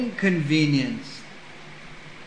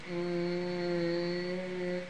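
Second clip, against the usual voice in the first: motor hum; 11 dB quieter than usual. Retold or imitated. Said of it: "electronically goes".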